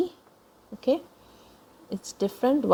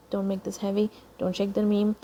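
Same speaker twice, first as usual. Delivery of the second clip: ordinary delivery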